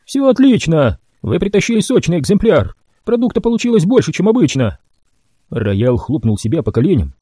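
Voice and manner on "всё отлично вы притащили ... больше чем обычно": high, excited mad doctor; the doctor delighted with the batch; gleeful doc. A narrator's reading. Doc character voice.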